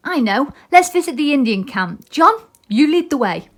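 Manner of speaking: lightly voiced eager boy; a boy with a bright idea; Peter Pan.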